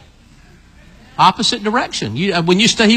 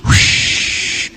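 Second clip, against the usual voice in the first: breathy rushing whoosh like wind. Shown as a demonstration. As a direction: blow it out like a gust. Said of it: "Everybody go".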